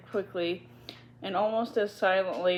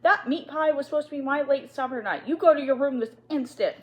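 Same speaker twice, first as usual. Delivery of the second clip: higher and louder furious command; angry order; 7 semitones higher, 5 dB louder than usual. Acted. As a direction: order her off, fuming. Headmistress ordering the maid.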